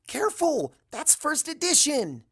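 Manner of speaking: high, loud cry of alarm; alarmed shout. A narrator's reading. Narrator shouting at Starswirl throwing the book, high alarmed shout. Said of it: "I shout at him".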